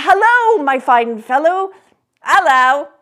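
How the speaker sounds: loud high lad's reply; street boy. Acted. The boy answering Scrooge from the street, cheeky. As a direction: call back up cheekily.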